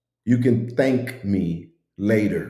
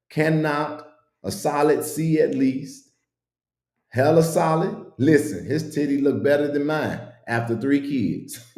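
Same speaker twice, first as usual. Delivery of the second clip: usual speaking voice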